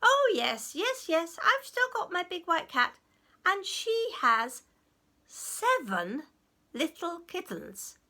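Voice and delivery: high, loud pretend enthusiasm; tricking goblins. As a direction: lay it on thick to fool them. He played a trick.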